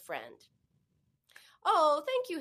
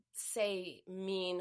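normal talking voice

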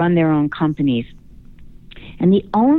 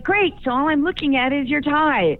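sarcastic; 8 semitones higher than usual. Retold or imitated. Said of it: "And I'm like".

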